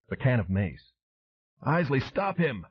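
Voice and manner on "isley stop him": high, slow hollered order. A narrator's reading. Flint yelling to Isley while hurt: slow holler. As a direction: holler it across the lot. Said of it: "he hollered".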